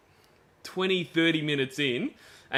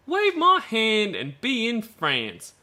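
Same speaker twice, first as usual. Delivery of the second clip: put-on dreamy girl voice; 8 semitones higher, slower than usual. Reading girl's chat message.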